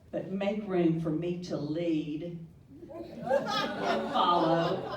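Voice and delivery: "follow" stretched slow and loud; stress on follow. Shown as a demonstration. Reading Maya Angelou to the room, stressing "follow" slow. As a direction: lean hard on "and follow", drawn out with a laugh. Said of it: "put that emphasis, the emphasis on that syllable".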